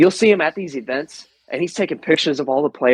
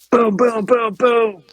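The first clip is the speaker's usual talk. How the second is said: high-pitched, even run of booms; imitated gunfire; 9 semitones higher, slower than usual. Storyteller mimics rifle firing by his ear, imitated gunfire. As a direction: voice rifle shots as punchy booms.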